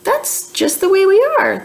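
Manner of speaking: high, proud little fish voice. Acted. The fish tells Trixie proudly in a high voice. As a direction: puff up proudly like a tiny fish. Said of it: "the fish says proudly".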